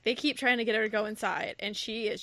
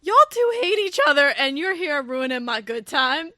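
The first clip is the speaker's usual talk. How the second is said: sassy heroine; 2 semitones higher, 8 dB louder than usual. Retold or imitated. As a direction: scold them like a fed-up Southern belle. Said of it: "she goes".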